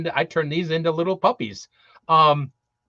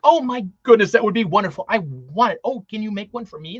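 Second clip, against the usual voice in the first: higher and louder, thrilled and eager; excited Santa; 5 semitones higher, 6 dB louder than usual. Retold or imitated. Santa thrilled by the elf's magic wand offer, excited. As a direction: gush like Santa hearing the best news ever. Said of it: "he's so excited to think".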